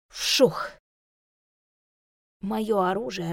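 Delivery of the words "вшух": whooshing swish. Acted. Swishing stick sound effect.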